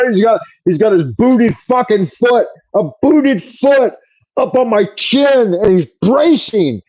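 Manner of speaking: normal talking voice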